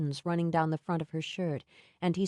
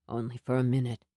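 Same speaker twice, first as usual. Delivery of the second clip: lower, gentle comfort; low soothing Sam; 7 semitones lower, faster than usual. A narrator's reading. Sam soothing her pain.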